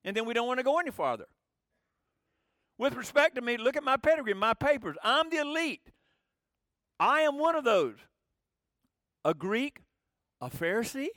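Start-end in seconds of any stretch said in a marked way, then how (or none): 2.8-11.1 higher, louder puffed-up bragging; proud boasting. Retold or imitated. Paul boasting to the crowd about his papers, puffed-up. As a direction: show off your pedigree like the elite.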